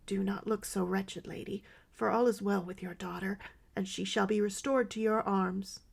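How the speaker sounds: ordinary delivery, nothing marked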